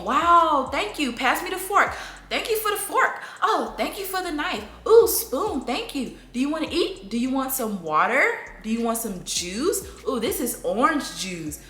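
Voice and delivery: put-on high sing-song toddler talk. Shown as a demonstration. Mom naming objects at dinner for toddler, high sing-song. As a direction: chirp every object name up high. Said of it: "you have to talk like that".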